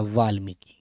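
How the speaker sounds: quiet demonstration; soft model word. Shown as a demonstration. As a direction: drop the voice to model Valmiki. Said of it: "छोटी की मात्रा".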